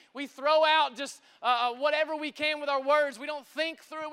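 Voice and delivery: normal talking voice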